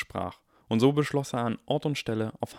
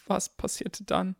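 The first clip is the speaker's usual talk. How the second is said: slow, shaky and much higher; trembling voice; 10 semitones higher, 3 dB quieter, slower than usual. Acted. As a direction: ask with a trembling voice. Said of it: "fragte Harry mit zitternder Stimme".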